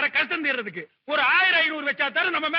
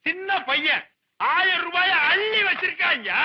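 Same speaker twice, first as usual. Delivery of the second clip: high, drawn-out proclamation; slow, high-pitched crowing; proud boasting; 4 semitones higher than usual. Acted. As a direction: show off the boy to the crowd. Boasting of nephew to crowd.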